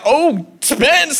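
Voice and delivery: a parent's half-laughing oh no; exasperated sigh. Retold or imitated. Toddler throws himself on floor.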